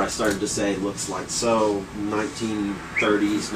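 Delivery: normal talking voice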